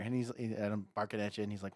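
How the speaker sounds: ordinary talk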